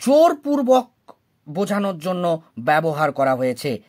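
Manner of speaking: normal talking voice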